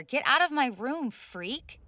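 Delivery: loud furious order to get out; angry shout. Acted. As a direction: yell him out of the room. Angry at intruder in room.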